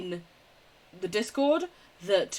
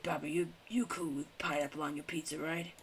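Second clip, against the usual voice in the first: whispered, much softer check-in; hushed aside; 4 semitones lower, 9 dB quieter than usual. Acted. Asking Baba in a whisper about pineapple, whispered. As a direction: lean in and whisper the question.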